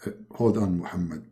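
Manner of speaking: low, soft godly caller; quiet, deep voice of authority; Allah. Acted. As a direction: speak low and hushed as a deity on the phone. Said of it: "and then Allah, he call him".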